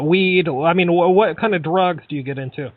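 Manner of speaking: ordinary delivery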